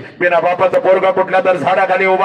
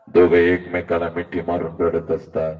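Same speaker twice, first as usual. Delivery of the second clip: hushed sorrow; 10 semitones lower, 5 dB quieter than usual. Acted. Quiet sorrowful narration.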